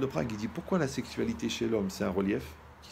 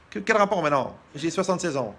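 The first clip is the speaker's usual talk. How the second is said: put-on much higher, much louder old lady answering the phone; old wife voice; 6 semitones higher, 8 dB louder than usual. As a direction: squeak it out like a confused old wife. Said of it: "quel âge vous avez".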